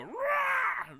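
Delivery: loud monster roar. Acted. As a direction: let out a big troll roar. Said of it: "can I go".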